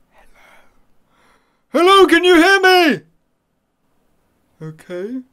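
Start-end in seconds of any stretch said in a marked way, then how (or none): 0.1-3.0 loud, high echoing call; calling into ear. Acted. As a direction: shout down the ear canal.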